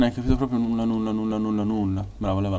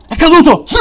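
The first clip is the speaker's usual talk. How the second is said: excited shout; 11 dB louder than usual. Real, not acted. Enemy falls, cheer.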